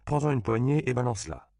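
high, loud insistent push; urgent command. A narrator's reading. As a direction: urge him on loudly. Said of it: "intima Lupe à son frère".

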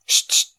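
voiceless, forceful "chut, chut"; loud hushing without voice; shushing. A narrator's reading. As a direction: hush the room sharply. Hushing others before a recital.

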